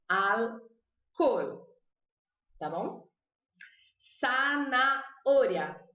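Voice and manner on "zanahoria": Zanahoria said slowly, z as s, h silent; slow sa-na-o-ria with no h sound; Spanish zanahoria. Shown as a demonstration. Teacher models zanahoria for class, z said as s. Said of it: "o Z tem som de S".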